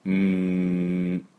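うーん as a very slow, sustained "mmm"; nasal hum on M for うーん; long M sound. Shown as a demonstration. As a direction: hold うーん level with lips closed. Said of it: "これはMの音です".

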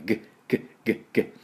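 グッ as short throat clicks without a full vowel; throaty G. Shown as a demonstration. Teacher drilling the G of bag, short throaty グッ. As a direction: sound グッ in the throat, teeth apart. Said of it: "飲み込む時のように".

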